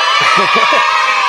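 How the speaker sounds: loud breathy laughing burst; loud burst of laughter. Real, not acted. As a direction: crack up loudly at the prank. Prank revealed, bursting laughter.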